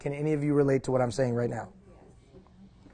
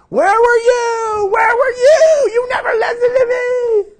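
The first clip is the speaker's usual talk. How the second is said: shrieked, very high and loud, on the edge of crying; screaming teen; 9 dB louder, slower than usual. Retold or imitated. Imagined teenage daughter screaming at her dad in the kitchen, tearful shriek. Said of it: "throwing plates at me in the kitchen screaming".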